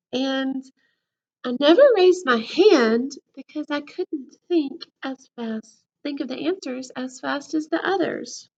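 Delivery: lively storybook voice, big swings of pitch; reading to children. A narrator's reading. Teacher reading a book to her class, lively storybook voice. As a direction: read it to the class like a picture book.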